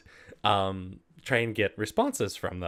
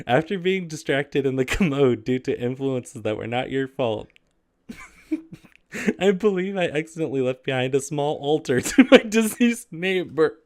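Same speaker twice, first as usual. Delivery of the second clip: higher, mock-formal letter reading; raised, overly proper read-aloud voice; 5 semitones higher, 6 dB louder than usual. Reads letter, starts cracking up.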